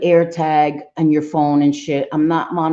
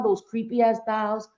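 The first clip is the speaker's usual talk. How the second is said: ordinary talk, nothing marked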